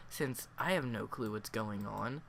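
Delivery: ordinary talk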